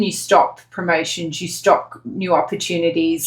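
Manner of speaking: usual speaking voice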